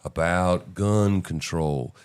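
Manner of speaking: drawn-out, slow mock delivery; slow right down to show her pacing; slow talk demo. Shown as a demonstration. Host slowly mimicking her pacing, slow and drawn out. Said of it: "she's talking like this".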